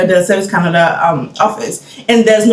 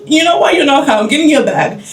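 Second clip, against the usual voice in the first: retold exasperated order; 6 semitones higher than usual. Acted counter lady taking charge.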